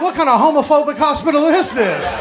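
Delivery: high, loud, laughing indignation; shocked patient yelling at the hospital; outraged shout. Retold or imitated. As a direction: blurt it out, scandalized. Outraged at the nurse's remark.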